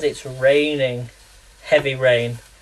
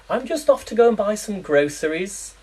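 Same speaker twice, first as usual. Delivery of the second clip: a lightly voiced prim Brit, a touch higher; mock Brit; 6 semitones higher than usual. Teasing American viewers, a lightly mocked Brit. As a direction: play a Brit saying the American word. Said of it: "I just can't imagine the Brits going around and saying".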